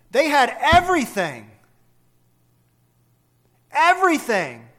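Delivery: everything cried out high and loud; emphatic. Acted. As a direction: hit everything hard, twice, with rising force. Preacher stresses the point.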